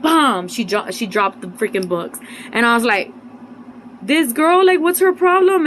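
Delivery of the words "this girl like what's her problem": higher, louder, slower indignant cry; indignant outburst. Retold or imitated. Reacting to the girl who knocked her books down, high and loud. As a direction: let the outrage rise as you ask it.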